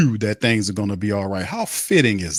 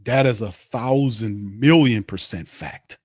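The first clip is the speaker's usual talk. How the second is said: ordinary delivery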